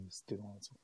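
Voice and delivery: fast, hushed secretive whisper. Pretend. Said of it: "小さい声でね".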